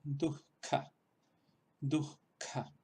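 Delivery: dujka with a breathy h before the k; visarga mid-word demo. Shown as a demonstration. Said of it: "Esto se pronunciaría así como".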